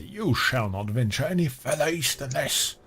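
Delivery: lightly voiced orc forbidding passage; orc warning. Orc warns the party off the east, commanding. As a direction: give a hint of a commanding orc, pitch lifted. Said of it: "he's standing there and say".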